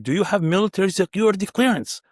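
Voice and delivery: put-on high, insistent Russian accent; imitated Russian guard. Russian guard asks the puzzled visitor again, in a Russian accent. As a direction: repeat the demand in mock Russian English. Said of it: "there's a Russian guard and he goes".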